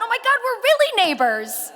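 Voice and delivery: high, loud delighted gasp of recognition; loud and high, overjoyed to find a neighbor; excited. Retold or imitated. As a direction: burst out with thrilled surprise. Excited retold reaction.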